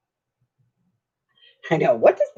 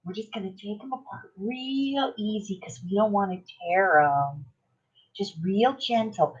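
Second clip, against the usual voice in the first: soft, higher-pitched imitation of a gentle crafter; sweet and gentle; 5 semitones higher, 9 dB quieter than usual. Crafter gently showing viewers how to pull layers apart, sweet and gentle. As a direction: mimic her real sweet, careful, hushed delivery. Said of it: "she's being real sweet".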